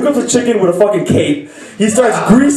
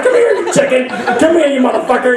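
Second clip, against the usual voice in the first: mock security guard yelling; 5 semitones higher than usual. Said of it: "He's like".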